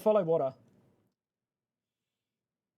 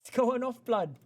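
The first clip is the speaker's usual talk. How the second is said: London roadman slang, pitched way up; mock London roadman accent; 7 semitones higher than usual. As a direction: put on a cocky London street accent.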